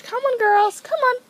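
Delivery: high, loud call to animals; calling pets. Real, not acted. Calling guinea pigs over.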